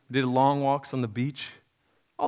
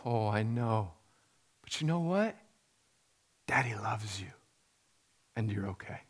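softer, hushed reassurance; soothing whisper to a child; 3 semitones lower, 8 dB quieter than usual. Retold or imitated. Father soothing his scared daughter, softly whispering. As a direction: comfort a frightened little girl. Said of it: "I said".

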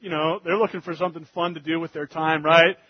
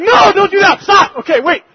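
much higher, louder panicked stop; panicked shout; 15 dB louder than usual. Retold or imitated. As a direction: yell to stop him at once. Stopping son blowing wrong.

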